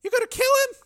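put-on very high, loud pleading girl; terrified girl. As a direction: shriek in terror.

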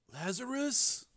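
high, very soft question; hushed puzzlement. A narrator's reading. Stunned brother echoing Frank, hushed puzzlement. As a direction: echo it faintly, unsure.

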